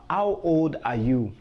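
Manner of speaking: usual speaking voice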